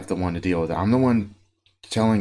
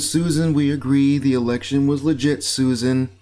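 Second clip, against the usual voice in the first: mock patronizing; 6 semitones higher, 4 dB louder than usual. Mock reassuring a conspiracist.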